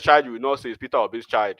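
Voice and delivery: ordinary talk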